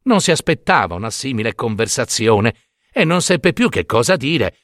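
ordinary delivery

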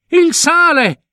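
il re ripete "Il sale!" ancora più in alto e più forte, quasi un grido di gioia; "Il sale!" strillato di gioia, voce altissima e un po' più forte; esclamazione felicissima. A narrator's reading. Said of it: "esclamò felice il re". (il re, fuori di sé per la gioia, ripete "Il sale!" con un grido acuto)

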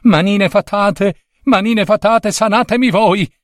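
high, insistent imploring; desperate high-pitched plea; urgent pleading. A narrator's reading. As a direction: beg in a high-pitched little prince's voice. Prince begs again more urgently.

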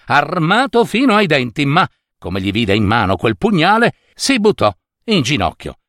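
ordinary delivery, nothing marked

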